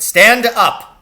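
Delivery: sharp parade-ground command; furious shout. Acted. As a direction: shout like a drill sergeant. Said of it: "he shouted".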